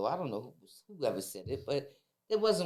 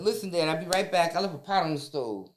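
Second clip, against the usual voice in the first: hurried fake excuse; 5 semitones higher, 7 dB louder than usual. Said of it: "I said".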